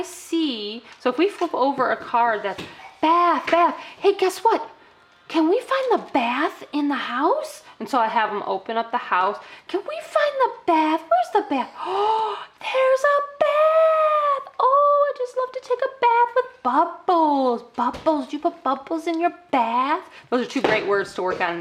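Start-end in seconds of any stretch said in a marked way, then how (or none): 3.0-7.6 higher, slowed, playful question; excited child-directed. Shown as a demonstration. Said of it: "I'm talking to you just like I would talk to them". 9.7-20.0 very high, drawn-out delighted gasp; excited child-directed. Shown as a demonstration. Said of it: "I'm talking to you just like I would talk to them".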